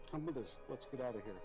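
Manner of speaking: ordinary delivery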